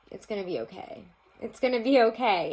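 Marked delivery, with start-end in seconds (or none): 1.4-2.5 higher, louder comforting; louder reassurance. Real, not acted. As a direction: say "It's gonna be okay" louder and higher, to comfort them.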